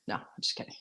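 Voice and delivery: whispered, joking take-back; whispered aside. Real, not acted. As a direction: drop to a whisper. Unvoiced aside after joke.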